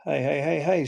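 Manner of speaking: usual speaking voice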